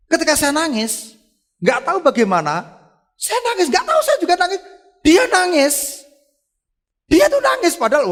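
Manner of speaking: high, loud preacher's exclamation; impassioned testimony. Real, not acted. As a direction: cry it out to the congregation. Excited climax of testimony.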